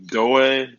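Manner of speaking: "Doe" drawn out high and loud, testing it; attempted pronunciation. Shown as a demonstration. Streamer trying a viewer's name, attempted pronunciation. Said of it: "let me try to pronounce your name".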